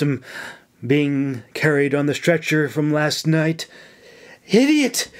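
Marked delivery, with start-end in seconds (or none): 4.5-5.1 high, exasperated outburst; sharp cry of frustration; angry curse. A narrator's reading. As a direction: curse at a reckless recruit. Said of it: "she cursed".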